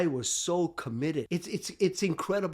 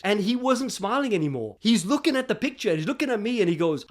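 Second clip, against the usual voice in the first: ordinary delivery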